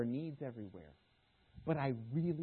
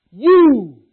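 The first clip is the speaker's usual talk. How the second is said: commanding Jesus; 5 semitones higher, 22 dB louder than usual. Acted. Jesus challenging disciples.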